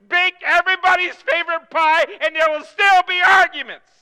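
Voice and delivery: loud, rising preacher's exhortation; preaching at full force. Acted. Preacher urging the congregation at full force. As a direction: drive it home to the congregation.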